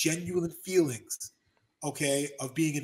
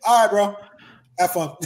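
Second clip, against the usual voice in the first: mock dismissive bro; 5 semitones higher, 9 dB louder than usual. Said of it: "He's like".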